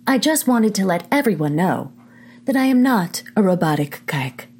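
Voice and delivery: stiff robotic announcer voice. Acted. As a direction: speak like a mechanical robot. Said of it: "I can't talk like that anymore".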